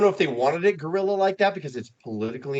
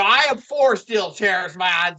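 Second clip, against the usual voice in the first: louder, higher mock wrestler protest; mock outburst; 5 semitones higher, 5 dB louder, slower than usual. Wrestler butting into a comedy skit, mock outburst. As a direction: declare it loudly like a hammed-up wrestler. Voicing Piper's skit reply.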